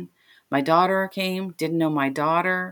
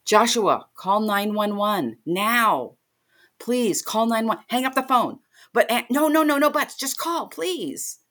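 high, pleading urgency; acted-out frantic phone plea; retold panic; 5 semitones higher than usual. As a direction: beg a child to act now, cutting off objections. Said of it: "I am totally panicking".

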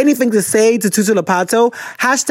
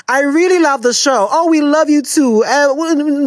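higher-pitched fan gush; 8 semitones higher than usual. Acted. Host answers a fan in the U S, gushing. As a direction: gush back in a lifted voice. Gushes back at a fan's greeting.

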